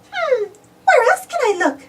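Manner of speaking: high, puzzled cartoon mouse voice; Minnie wondering. Minnie searches the kitchen for green, high puzzled voice. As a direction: wonder aloud, high and curious. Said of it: "she said".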